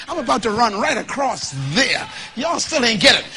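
ordinary talk

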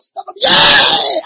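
high, loud, drawn-out church whoop; long exuberant holler; excited shout. Retold or imitated. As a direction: shout as if moved by the sermon. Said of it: "So I can just shout".

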